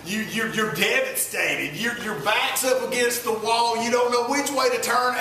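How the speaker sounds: usual speaking voice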